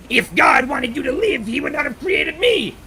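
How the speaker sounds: loud, high put-on soldier voice; bad mercenary impression. Streamer doing rapid-fire game impressions, loud high boast. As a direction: declare it high and loud, hammed-up. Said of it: "Here are my shitty impressions".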